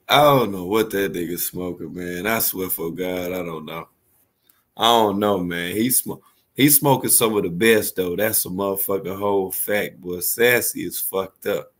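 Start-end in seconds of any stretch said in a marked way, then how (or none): none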